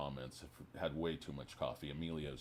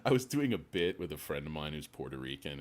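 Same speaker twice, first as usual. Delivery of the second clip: ordinary delivery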